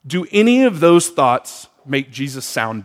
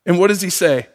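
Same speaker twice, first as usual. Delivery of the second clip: usual speaking voice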